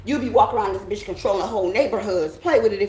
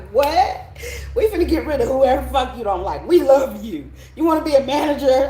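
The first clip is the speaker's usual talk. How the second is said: louder, higher excited offer; higher and louder eager pitch to keep her; eager boss; 5 semitones higher, 5 dB louder than usual. Retold or imitated. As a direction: pump it up like a boss courting a star. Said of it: "I used to be like".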